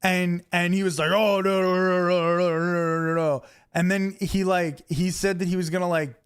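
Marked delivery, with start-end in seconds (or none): 1.1-3.4 slightly raised, run-together mock protest; mocking babble. Imitating the fan's comeback to a telling-off, mocking babble. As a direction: drone out his objections as nonsense.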